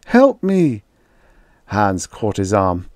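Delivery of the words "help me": higher, louder cry for help; desperate cry. A narrator's reading. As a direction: plead for help in panic. Calling for help.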